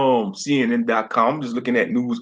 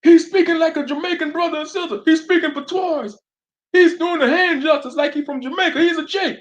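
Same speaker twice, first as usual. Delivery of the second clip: much higher, mocking preacher voice; mocking street preachers. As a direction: ham up the know-it-all preachers. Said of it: "They gonna look at [name] and be like".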